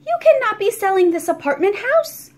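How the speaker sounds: high, loud disbelieving protest; boy objecting to the sale; dismayed protest. A narrator's reading. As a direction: push back in shock.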